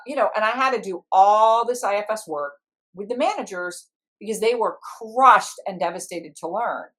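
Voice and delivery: ordinary talk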